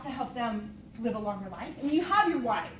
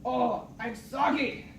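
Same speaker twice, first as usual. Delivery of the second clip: imitating Tony; much slower than usual. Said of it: "And he's like".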